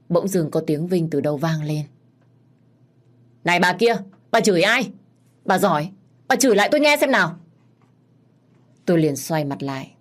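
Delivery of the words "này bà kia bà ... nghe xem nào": loud, higher-pitched angry challenge; belligerent, daring them to repeat it; aggressive confrontation. A narrator's reading. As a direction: square up to someone verbally. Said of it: "Vinh đang hùng hổ nói với bà Tám".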